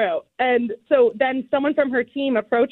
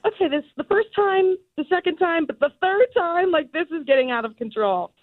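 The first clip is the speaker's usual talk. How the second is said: higher, mock-exasperated family voice; 6 semitones higher than usual. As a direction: imitate fed-up relatives, pitched up. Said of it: "everyone in my family and friends is like".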